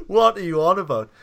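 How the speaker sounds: loud, high laughing "what are you on about"; laughing disbelief. Retold or imitated. Man retelling his baffled reply to angry girlfriend, laughing. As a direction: blurt it out while laughing.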